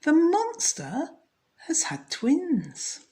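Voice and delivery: high, slow and amazed. Acted. Wife announcing the twins to her husband, amazed. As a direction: draw it out in wonder.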